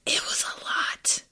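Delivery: hushed gossipy whisper. Real, not acted. Viewer guessing his past, in a whisper.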